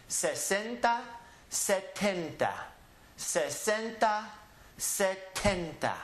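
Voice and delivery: crisp S in Sesenta and sharp T in setenta; careful enunciation. Shown as a demonstration. Teacher contrasting sesenta and setenta for students. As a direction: mark the S and T clearly. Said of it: "very carefully enunciate the S or the T".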